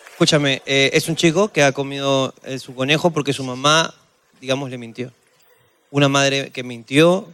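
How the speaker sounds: voz grave y baja de presentador; resume con seriedad fingida; conductor solemne. Acted. Conductor concluye con tono grave. (presentador grave y solemne)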